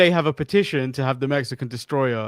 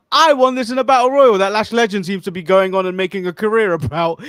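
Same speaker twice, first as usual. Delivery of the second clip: mock outraged quote; 4 semitones higher, 7 dB louder than usual. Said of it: "I would have said".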